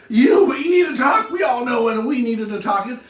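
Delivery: loud, high mock-furious yell at the partner; angry shout. Partner explodes at "we need to talk.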